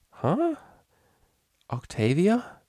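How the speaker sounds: high, rising, puzzled voice; dazed questioning. A narrator's reading. Hungover unicorn recognising her friend, dazed questioning. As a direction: ask it as if barely awake.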